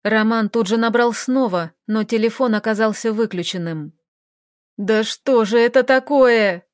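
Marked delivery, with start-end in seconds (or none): 4.8-6.6 громкий медленный вопль; взвыть от бессилия; взвыл. Acted. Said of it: "взвыл он". (Роман воет от отчаяния, громко)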